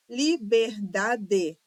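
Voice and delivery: "Liberdade" slowly as li-ber-da-de, full final E; syllable by syllable. Shown as a demonstration. Teacher checking syllable division, "Liberdade" syllable by syllable. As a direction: sound out "Liberdade" in four beats. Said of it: "divisão silábica de todas as palavras".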